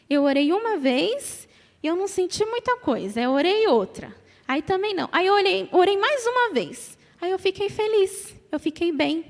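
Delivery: little daughter's voice. Retold or imitated.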